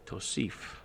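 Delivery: calming. Retold or imitated. Manager calming angry employee, soft and low. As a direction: settle him down gently.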